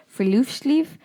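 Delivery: friluftsliv said as free-loofs-leev, rising; trying the Norwegian friluftsliv with a questioning rise; careful try at Norwegian. Shown as a demonstration. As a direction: sound out friluftsliv carefully, pitch going up. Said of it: "I'm gonna say".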